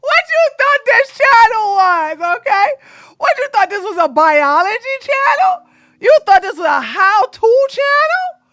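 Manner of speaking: mocking shout. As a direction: taunt them with a wailing shout. Mocking viewers' expectations.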